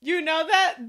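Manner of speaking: ordinary delivery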